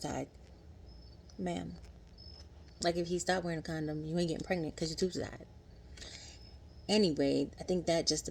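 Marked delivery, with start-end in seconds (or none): none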